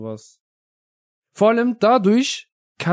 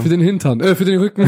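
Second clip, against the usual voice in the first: mock the slip, breaking into laughter; higher teasing echo with a laugh; teasing mimicry; 4 semitones higher, 4 dB louder than usual. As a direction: imitate the stumble jokingly.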